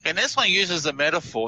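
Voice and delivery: usual speaking voice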